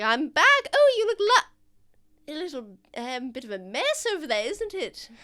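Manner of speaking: high, chatty shopkeeper voice; a lightly voiced fussy shopkeeper. Shopkeeper greeting the rat, lightly voiced. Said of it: "she's like".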